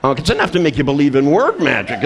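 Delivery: normal talking voice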